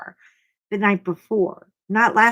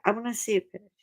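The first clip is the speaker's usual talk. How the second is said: usual speaking voice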